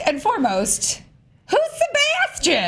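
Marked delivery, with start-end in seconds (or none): 1.5-2.7 high, slow, drawn-out question; dramatic high voice. Acted. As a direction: ask it like a big mystery.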